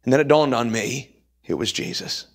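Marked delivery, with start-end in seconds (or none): 1.4-2.3 low whispered awe. Real, not acted. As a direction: breathe it out in awe. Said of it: "hushed realization".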